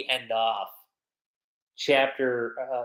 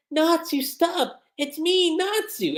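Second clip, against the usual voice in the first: put-on high pleading girl voice; high voiced plea to Natsu; Lucy pleading; 12 semitones higher, slower than usual. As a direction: beg him to stop in a high girlish voice. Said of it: "she's like".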